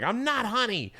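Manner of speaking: high-pitched indignant mimicry; indignant retort. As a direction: imitate her bristling at the word. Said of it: "she was like".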